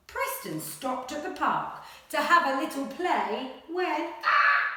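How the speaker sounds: animated picture-book read-aloud; reading to children. Acted. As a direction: read it like a bedtime adventure. Story reading continues.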